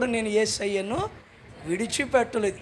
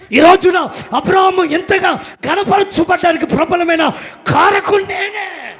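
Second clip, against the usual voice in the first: loud preaching; 6 semitones higher, 9 dB louder than usual. Acted. Preaching at full force.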